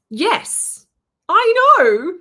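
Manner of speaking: high loud excited outburst; excited exclaim. Real, not acted. Telling her friend about the roller banner, excitedly loud.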